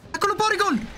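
high, frantic cries at the fleeing Porygon; excited yelling. Real, not acted. Player yelling at an escaping Porygon, high frantic cries. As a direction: shriek after it as it runs.

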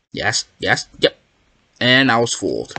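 ordinary delivery

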